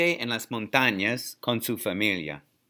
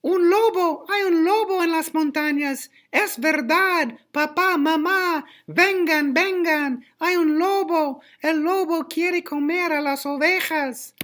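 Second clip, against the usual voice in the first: put-on boy shouting. A narrator's reading. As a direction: yell out in a high, piercing boy voice. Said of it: "El muchacho grita".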